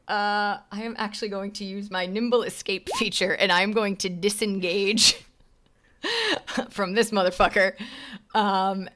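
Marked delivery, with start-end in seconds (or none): 6.0-6.6 squealing laugh; high laugh. Real, not acted. Gleeful laugh at own move.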